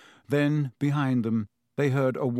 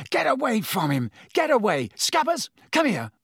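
shouting; 10 semitones higher than usual. Acted. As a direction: chase the cat off, yelling. Ron shouting at the cat.

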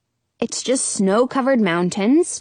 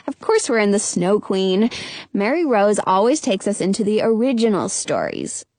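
ordinary delivery

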